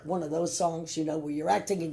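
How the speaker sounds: normal talking voice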